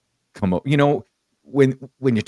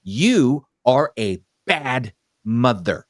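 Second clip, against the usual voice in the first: very slow, word by word; slow, weighty retold reproach; deliberate verdict; much slower than usual. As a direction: spell out the accusation, pausing after each word. Retold verdict said to her face.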